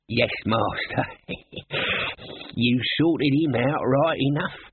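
put-on grumbling old servant with a wheezy laugh, slow; an old retainer, dry and knowing; Albert the servant. Albert replies to his master, dry and slow.

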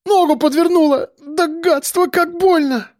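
very high woman's wail; pained cry. A narrator's reading. Woman falls and twists her foot, pained cry. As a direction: cry out over a twisted ankle. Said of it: "вскрикнула".

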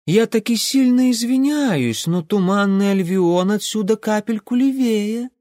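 high, polite Odessa lilt; Odessa-style voice. Acted. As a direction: apologize with a sly folksy lilt. Alf's apologetic folksy speech.

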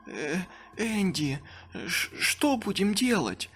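slow, scared stammer; frightened, tripping over words. Acted. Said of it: "запинаясь". (запинаясь от страха)

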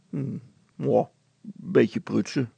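noncommittal grumble; mumbled brush-off; grumbling. A narrator's reading. Brilstra evades his wife's question, grumbling. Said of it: "bromde Brilstra".

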